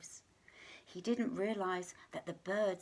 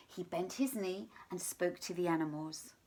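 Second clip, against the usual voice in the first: plain reading voice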